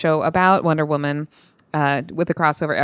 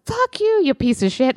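retold angry yell; 10 semitones higher than usual. Woman yelling back at a drunk catcaller, retold indignant yell. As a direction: reenact yelling back at a heckler. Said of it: "I'm yelling at him".

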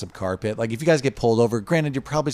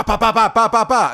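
gunshot noises; 10 semitones higher, 5 dB louder than usual. Retold or imitated. Imitating gunshots in rave music.

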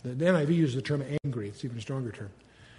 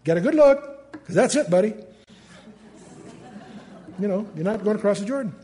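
higher and louder, casual and blunt; blunt God; 6 semitones higher, 6 dB louder than usual. Retold or imitated. God bluntly tells Moses to look, not cross. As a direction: tell him straight, buddy. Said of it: "God's saying".

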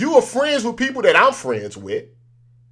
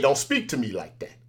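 quiet firm warning; 6 semitones lower, 7 dB quieter than usual. Retold or imitated. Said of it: "when I said".

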